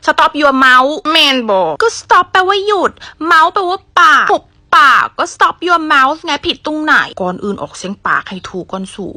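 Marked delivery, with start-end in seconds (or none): 0.1-0.9 louder, punchy STOP; forceful shout. Shown as a demonstration. Teacher showing students a satisfying forceful shout. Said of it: "ออกเสียงแบบนี้บ่สะใจ ต้อง". 5.3-6.2 1 forceful. Shown as a demonstration. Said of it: "ต้อง".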